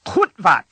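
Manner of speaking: harsh Kutvat with a throaty k and abrupt cut-off; Klingon curse. Shown as a demonstration. Teacher models a Klingon word of anger, Kutvat harsh and clipped. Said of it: "moments of extreme anger".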